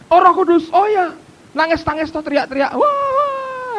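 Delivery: high, loud mock exclamation; hammed-up high-pitched mockery; dismissive churchgoer. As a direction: parody a scoffer imitating wailers, rising into a high wail. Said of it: "mesti berkata".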